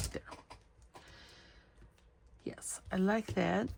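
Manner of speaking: whispered, pleased aside; soft whispered approval. Real, not acted. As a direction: breathe it to yourself. Quiet satisfied aside to herself.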